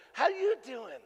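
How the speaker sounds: high, bright mock flight attendant greeting; cheery greeting. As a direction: chirp it like a grinning flight attendant. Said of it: "biggest smile on her face".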